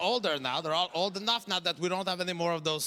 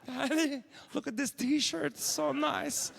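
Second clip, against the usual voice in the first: higher, gushing daughter voice; excited daughter; 5 semitones higher, 4 dB quieter than usual. Retold or imitated. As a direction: squeal over the shirt like a thrilled teen. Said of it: "She's like".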